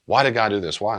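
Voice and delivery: a grieving mother pleading with God; anguished why. Retold or imitated. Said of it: "woman whose son committed suicide".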